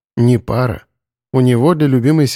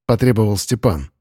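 usual speaking voice

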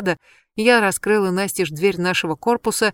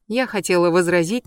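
usual speaking voice

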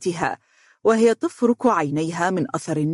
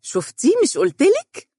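put-on very high, gloating child; squeaky little told-you-so; triumphant; 12 semitones higher than usual. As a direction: crow it like a victorious kid. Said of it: "في انتصار وهي تقول".

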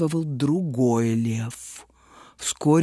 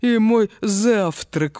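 smirking; 4 semitones higher, slower than usual. A narrator's reading. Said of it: "ухмыльнулся он".

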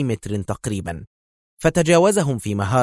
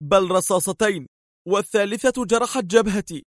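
tense answer; 10 semitones higher than usual. A narrator's reading. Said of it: "أجابها متوترا".

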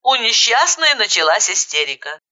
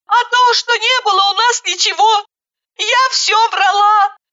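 high tearful lament; tearful despair; 12 semitones higher, 4 dB louder than usual. A narrator's reading. Bride despairing how to explain to Misha, high. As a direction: lament high through tears.

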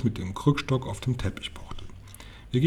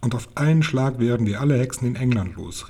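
ordinary delivery

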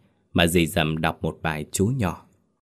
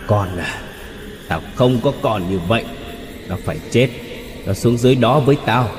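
cold menace; 3 semitones higher than usual. A narrator's reading. Vengeful father disowning his son, cold menace rising. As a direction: build from cold refusal to a raised death threat. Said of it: "tiếng cười khàn lạnh lẽo".

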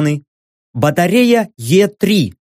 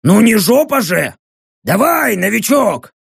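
rough urging shout; 5 semitones higher than usual. Acted. Orc urging newbie up the tree.

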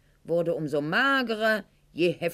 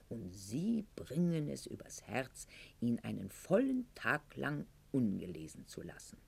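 hushed reproach; 5 semitones lower, 9 dB quieter than usual. Acted. Lover's reproach drops to whisper.

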